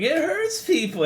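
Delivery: very high, strained mock scream; imitated screaming. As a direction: imitate a friend screaming in protest, slow and high. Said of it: "he was screaming".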